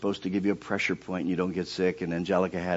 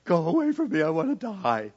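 mock groan; 8 semitones higher than usual. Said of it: "and I'm going".

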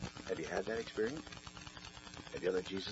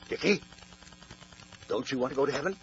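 higher, louder coaxing sister's call; pleading big sister; 6 semitones higher, 8 dB louder, faster than usual. Retold or imitated. Older sister urging little Dickie toward heaven, higher and louder pleading. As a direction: lean in and plead with a small brother.

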